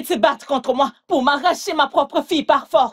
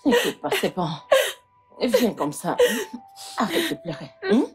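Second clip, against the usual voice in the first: much softer and lower, soothing; tender comfort; 5 semitones lower, 5 dB quieter than usual. Acted. Mother soothing her crying daughter, soft and tender. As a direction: hush her gently through her tears.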